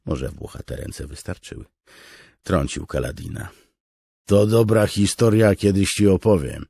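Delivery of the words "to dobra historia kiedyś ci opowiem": put-on high, loud boastful old soldier; bragging promise. Beard nudging Kaladin, loud boastful promise. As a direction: dangle the tale as bait.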